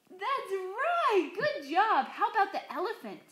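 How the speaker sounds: high, loud and bright praise; excited praise. Real, not acted.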